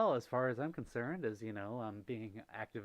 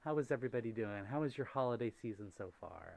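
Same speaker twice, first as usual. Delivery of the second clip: ordinary talk, nothing marked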